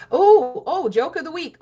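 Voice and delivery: high, loud hype for the joke; game-show style hype; excited announcement. Real, not acted. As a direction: kick off the segment with energy.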